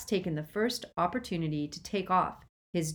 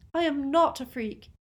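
high, wounded denial; hurt protest; 10 semitones higher, 4 dB louder than usual. A narrator's reading. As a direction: protest the insult, pitched well up. Lily hurt, denies the insult.

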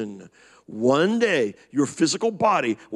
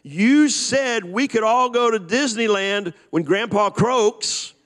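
put-on louder child; 6 semitones higher than usual. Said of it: "said even louder".

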